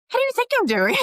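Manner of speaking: high, loud, exasperated snap back; sarcastic retort. Retold or imitated. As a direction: throw the question back, openly sarcastic. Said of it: "comes across very rude, sarcastic".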